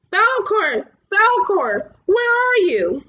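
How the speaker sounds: high, loud desperate call; shouting. A narrator's reading. Atreyu shouts for his lost dragon, loud and high. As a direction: call across the beach. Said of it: "shouting in all directions".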